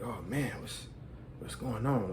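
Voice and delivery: low, hushed and tense; mock hushed suspense. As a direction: whisper it, gripped by the scene. Watching an intense movie part.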